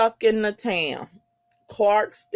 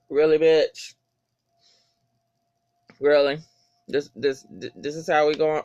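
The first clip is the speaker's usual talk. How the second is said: retold skepticism; 5 semitones lower than usual. Acts out own skeptical reaction.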